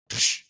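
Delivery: short hissed crash sound; mock splat effect; imitated impact noise. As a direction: make the sound of a body hitting down. Imitates the back snapping on impact.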